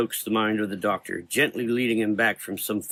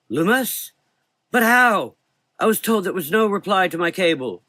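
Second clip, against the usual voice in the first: put-on high, loud, startled old voice; surprised old man; 9 semitones higher, 6 dB louder than usual. A narrator's reading. Old doctor startled by his visitor, high and loud. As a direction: gasp it out in disbelief.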